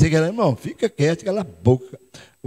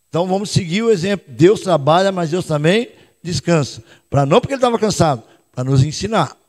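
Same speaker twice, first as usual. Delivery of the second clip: usual speaking voice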